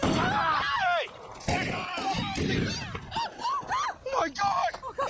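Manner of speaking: high, dragged-out horrified cry; stretched "Oh my God!" in shock; panicked screaming. Acted. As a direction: scream in horror as someone goes down. Panic after shooting.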